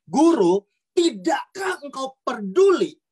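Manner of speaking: loud, high drawn-out outcry; loud pleading cry in a storm; disciples crying out. Acted. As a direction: call out slowly and forcefully to the teacher. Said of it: "engkau berkata seperti murid-murid".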